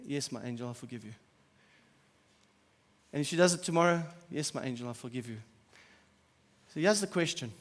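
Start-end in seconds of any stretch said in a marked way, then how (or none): 0.1-1.1 soft, gentle fatherly answer; a father's gentle yes; tender reply to daughter. Pretend. 4.3-5.4 soft, gentle fatherly answer; a father's gentle yes; tender reply to daughter. Pretend. Same tender reply repeated.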